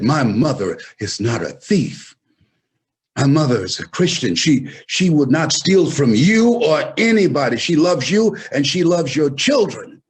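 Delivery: loud, rising defence of his mother; defiant protest. Retold or imitated. Ten-year-old boy defending his mother to the employer, loud. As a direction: stand up and speak firmly for her.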